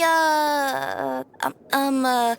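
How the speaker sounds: drawn-out uhs and ums; hesitant searching. Acted. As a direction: stall while hunting for the word. Groping for an unfamiliar word.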